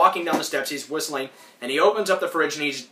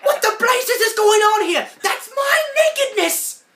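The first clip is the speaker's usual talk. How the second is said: much higher, louder cartoon outrage; outraged shout; 5 dB louder than usual. Rocco outraged at footage of himself, high furious shout. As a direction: yell it like a scandalised cartoon wallaby. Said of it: "Rocco's like".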